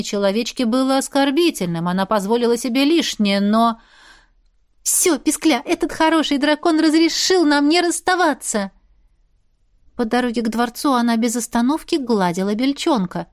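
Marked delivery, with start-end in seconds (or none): 4.8-8.7 put-on high, elated girlish voice; joyful and pitched up; happy pet talk. A narrator's reading. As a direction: share good news with a little pet. Girl happily tells the squirrel.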